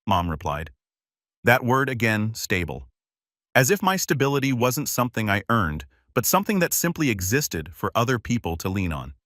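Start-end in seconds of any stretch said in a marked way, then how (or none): none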